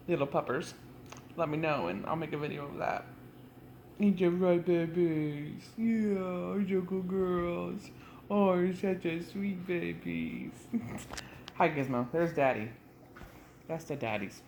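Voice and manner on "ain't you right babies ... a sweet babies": talking to a dog. Real, not acted. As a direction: coo at the puppies. Cooing to the dogs.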